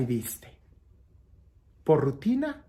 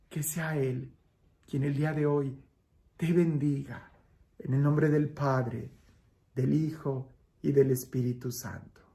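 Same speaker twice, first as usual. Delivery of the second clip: soft, unhurried benediction with pauses between phrases; priestly blessing; 5 dB quieter than usual. Real, not acted. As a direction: bless the listeners quietly, letting it settle. Gives the closing blessing.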